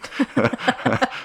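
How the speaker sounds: a burst of laughter, pitched high; sheepish high giggle; high embarrassed laugh. Real, not acted. Laughing at her grammar slip.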